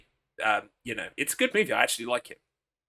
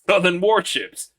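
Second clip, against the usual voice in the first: put-on high, loud southern US accent; hammed-up southern American; 5 semitones higher, 6 dB louder than usual. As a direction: push the southern accent higher and louder. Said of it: "whenever I try to do the southern voice".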